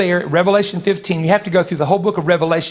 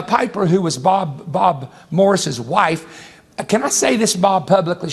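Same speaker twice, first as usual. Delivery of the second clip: ordinary talk, nothing marked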